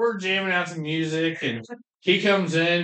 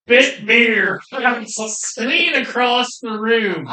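excited retelling; 6 semitones higher, 4 dB louder than usual. Real, not acted. Everyone spits beer laughing.

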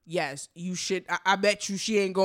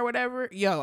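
ordinary talk, nothing marked

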